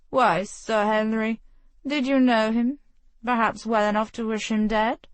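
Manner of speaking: bait him with a smile in the voice; sly accusing prince. Acted. Prince taunts Sir Henry about the dead man, sly.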